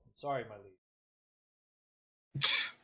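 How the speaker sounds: soft, slow apology to a master; servant's apology. Acted. Game master voices the follower apologizing to his liege, softly. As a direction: answer quietly, as a deferential follower. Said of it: "another voice that says".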